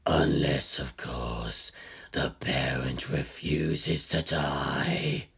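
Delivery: low, slow, otherworldly pronouncement; ominous deep voice. A narrator's reading. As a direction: intone it like a dark oracle. Ominous aside in narration.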